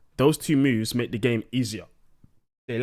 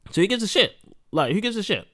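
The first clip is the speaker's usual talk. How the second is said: exasperated; 8 semitones higher than usual. Real, not acted. Ranting about broken mechanics.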